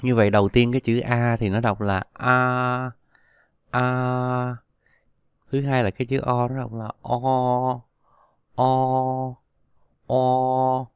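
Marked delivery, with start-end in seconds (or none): 2.1-4.6 long, level A with the mouth wide open. Shown as a demonstration. Teacher models the vowel A for learners, open-mouthed and drawn out. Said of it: "há miệng to ra, đọc là A". 7.0-10.9 long, level O with rounded mouth. Shown as a demonstration. Teacher shows how O is read, rounded and drawn out. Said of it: "nó tròn miệng lại".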